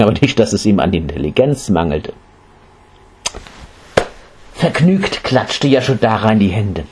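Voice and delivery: ordinary delivery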